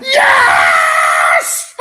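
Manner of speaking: loud, low roar of victory; triumphant yell. Real, not acted. Final whistle celebration.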